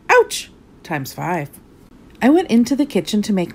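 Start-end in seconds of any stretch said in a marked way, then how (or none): 0.1-0.5 sharp high yelp of pain; sudden little cry of hurt; pained ouch. A narrator's reading. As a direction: yelp as you hit the wall. Lands at bottom of stairs.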